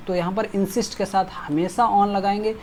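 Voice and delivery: ordinary talk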